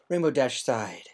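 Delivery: usual speaking voice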